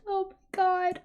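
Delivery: usual speaking voice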